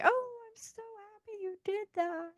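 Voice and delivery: very high, soft, sugary fake delight; fake gushing. Mocking the fake praise that makes people angry. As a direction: squeal out fake happiness. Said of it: "You don't have to get that fake".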